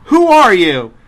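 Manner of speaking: loud, high startled question; startled demand. Retold or imitated. Nancy confronting Freddy in her dream, loud startled demand. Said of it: "She's like".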